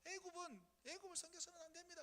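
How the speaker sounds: a lightly voiced tearful prophet; pleading prophet. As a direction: plead with the people like Jeremiah. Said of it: "눈물의 선지자라고 해가지고 이렇게 얘기하면".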